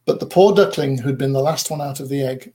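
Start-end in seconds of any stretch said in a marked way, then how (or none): none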